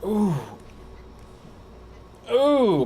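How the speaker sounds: high drawn-out groove moan; stank-face "ooh". Acted. Fan lost in his favorite band's music, moaning in pleasure. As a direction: let out a high, delighted "ooh". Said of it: "the whole time I'm listening to their music".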